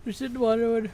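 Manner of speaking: talking through a yawn; words stretched by a yawn. Real, not acted. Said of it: "random bad time for a yawn".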